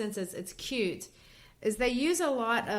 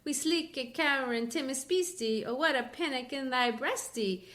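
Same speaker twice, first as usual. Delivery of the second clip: lightly beating iambic lilt, pitched a bit higher; rhythmic recital; 4 semitones higher than usual. Shown as a demonstration. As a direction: read it like a heartbeat, ba-dump ba-dump. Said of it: "it gives it kind of a light beat".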